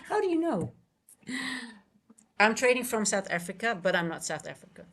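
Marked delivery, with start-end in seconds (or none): 0.1-0.7 much higher pitch with a sharp fall; amused disbelief. Real, not acted. Trader to viewer who guessed where they trade from, amused disbelief. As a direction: ask it high, amused and incredulous. Repeats it after laughing, higher.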